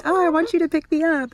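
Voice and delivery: very high, loud cutesy plea; pet voice. Pretend. Owner voicing her pet wanting to be picked up, very high cutesy voice. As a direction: coo it as the pet wanting to be held.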